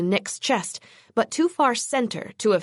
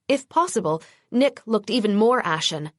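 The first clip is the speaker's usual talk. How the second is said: ordinary delivery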